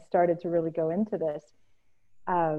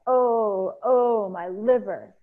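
loud, raised, long moaning "oh"s; acted wail of a suffering queen; crying out in pain; 3 semitones higher, 6 dB louder, much slower than usual. Ereshkigal moans over her liver, crying out in pain. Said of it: "Ereshkigal cried out".

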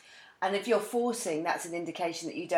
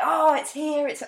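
high, thrilled gasp of delight; excited; 9 semitones higher, 5 dB louder, slower than usual. Retold or imitated. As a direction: sound giddy and high. Voicing excited new love.

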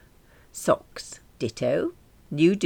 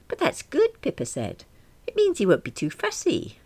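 high, brisk, cheerful Pippa; chirpy Pippa; 8 semitones higher than usual. Acted. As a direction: sell it like it's great news. Pippa voiced brightly.

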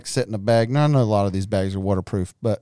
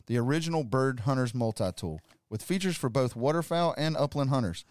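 ad read; 10 dB quieter than usual. Acted. Reads sponsor ad.

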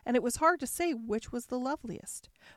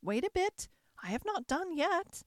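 put-on much higher, lilting old fairy voice; fairy godmother voice; 9 semitones higher than usual. Godmother tells Cinderella to wait, high sprightly fairy voice.

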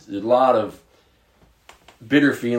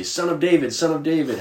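calling out; 8 semitones higher, 5 dB louder than usual. Acted. Blind men calling out to Jesus.

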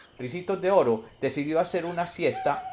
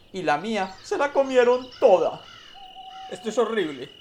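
high, whiny little cub voice; baby bear; 9 semitones higher than usual. Acted. Baby bear complaining his porridge is gone, high childlike voice. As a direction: squeak it out like a small upset child bear. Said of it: "el bebé oso dijo".

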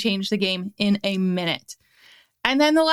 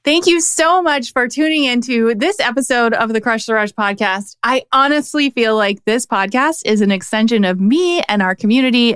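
louder, upbeat host outro; bright closing read for the podcast; 6 dB louder than usual. Acted. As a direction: sell the show to listeners.

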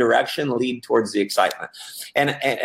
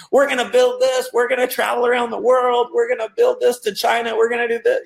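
high, breakneck-hype imitation of a keen recruit; all just excitement; 10 semitones higher than usual. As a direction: rattle off big dreams in mock excitement. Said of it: "It was all just excitement".

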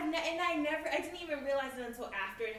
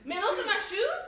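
puzzled guest; 10 semitones higher, 7 dB louder than usual. Retold or imitated. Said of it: "he was like".

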